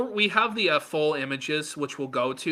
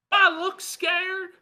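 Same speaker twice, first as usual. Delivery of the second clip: mocking Ralph; 12 semitones higher than usual. Host mimicking Ralph's boast in a high smug voice. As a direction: puff up like a self-important internet star. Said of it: "this is Ralph being harassed".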